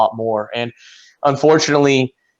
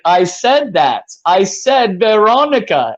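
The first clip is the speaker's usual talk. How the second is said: higher, drawn-out insistence; retold exasperated learner, stretching the words; frustrated protest; 5 semitones higher, slower than usual. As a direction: insist you already said it, pitch climbing. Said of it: "And I was like".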